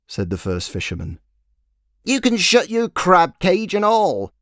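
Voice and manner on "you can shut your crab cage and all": high, slow shrill-voiced wife scolding her husband; scolding fishwife. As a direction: nag him down the street, high and loud. Wife snaps back.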